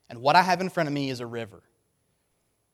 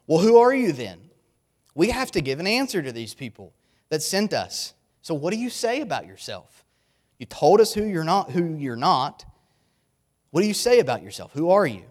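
louder, mock-exasperated questioning; pressing interrogation; 3 semitones higher, 7 dB louder than usual. Questioners demanding an answer from John, pressing and impatient. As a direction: push for a straight answer, voice raised.

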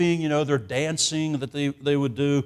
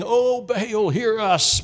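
high, drawn-out mock cry to a false god; calling on Baal; 8 semitones higher, 5 dB louder, slower than usual. Preacher acting out Baal's prophets pleading, high and drawn out. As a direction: wail it up to the sky, slowly.

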